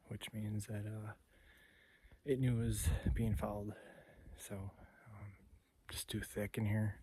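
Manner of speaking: quiet, hushed narration; wildlife watching whisper. Real, not acted. Hunter talking quietly in thick cover.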